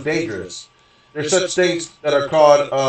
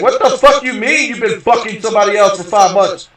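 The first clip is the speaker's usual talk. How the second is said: retold angry shout; 6 semitones higher, 6 dB louder than usual. Retold outburst at cheating girlfriend.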